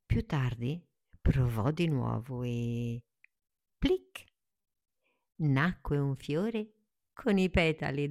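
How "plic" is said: high bright pop; plic pop sound. Acted. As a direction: pop it out like a bud bursting. Flower pops open.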